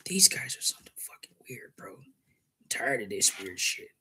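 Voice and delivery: hushed whisper, tired of it. Real, not acted. Complaining to Ghost about weird guys, whispered.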